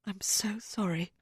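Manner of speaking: slow, breathy whispered sorry; tearful whispered apology. Acted. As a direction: barely get the apology out. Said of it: "I can't hear you".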